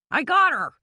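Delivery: high, loud call in a man's voice. A narrator's reading. Billy calls to Bannister that he has her, loud. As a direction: shout it to the man in the trees. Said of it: "Billy called to Bannister".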